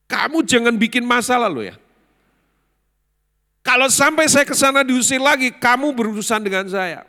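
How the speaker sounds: loud, high enraged outburst; furious warning. Retold or imitated. Son warning his father's employee off, raging.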